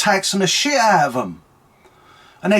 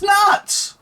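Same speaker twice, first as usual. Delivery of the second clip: shouting that it's crazy; exasperated shout. Real, not acted. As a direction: blurt it out in disbelief. Ranting at the news.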